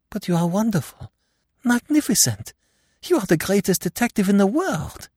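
high, breathless gush of admiration; awed admiration. Acted. Martha dazzled after Poirot explains the case, gushing. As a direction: gush over him, dazzled.

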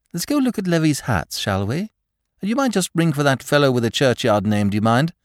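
high, breezy aristocrat; breezy Lord Peter. Acted. Lord Peter sending for the butler, breezy and high. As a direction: toss it off lightly, high.

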